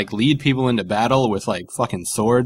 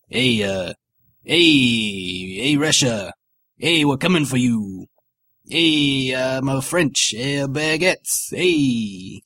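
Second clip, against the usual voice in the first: lightly put-on French accent, baguettes drawn out; a half-hearted French impression; mock French accent; much slower than usual. Napoleon calling Russia, in a lightly mocked French accent. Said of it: "my French impression is this".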